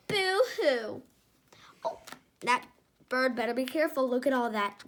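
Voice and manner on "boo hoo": mock boo-hoo. Mock Who crying.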